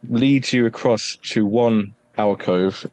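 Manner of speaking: usual speaking voice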